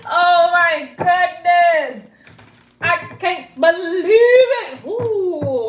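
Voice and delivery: exaggerated theatrical shock, high and loud; mock disbelief. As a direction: ham up the disbelief, stretch every word. Mock shock after laughing.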